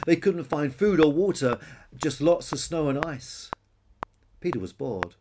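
ordinary delivery, nothing marked

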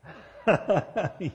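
loud high burst of laughter; real amused laugh. Real, not acted. Preacher amused by own elbow joke, laughing.

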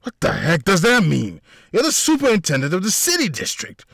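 high, loud indignant retort; firing back. A narrator's reading. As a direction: snap back at her in outrage. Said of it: "Mikael fired back".

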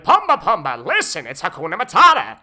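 high, loud Timon-style Nathan Lane; brassy Broadway comic voice. Acted. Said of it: "my thing with".

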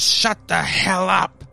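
slow, high-pitched angry demand; furious order, imitated. Maury shouting down the crying baby, imitated fury. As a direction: drag out each word in acted fury.

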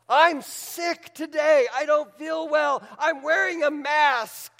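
high, drawn-out acted protest; anguished complaint. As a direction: plead it high, like a frustrated doubter. Acts out a doubting, hurting listener.

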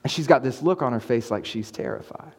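usual speaking voice

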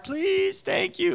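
put-on high, drawn-out sweet little-kid plea; put-on tiny child's voice, very high; cute toddler. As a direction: imitate a heart-melting toddler saying it. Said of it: "melts your heart".

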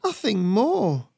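high, slow wheezy beaver voice; wheezing beaver. A narrator's reading. Injured beaver excusing himself to attacker, high wheeze. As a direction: plead in a strained, high wheeze. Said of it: "the beaver wheezed".

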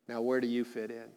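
normal talking voice

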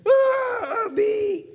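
high, loud imitated little-girl scream; hammed-up girl's fright; mock scream. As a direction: shriek like a scared child. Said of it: "granddaughters deathly afraid".

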